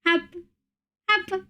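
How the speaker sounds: normal talking voice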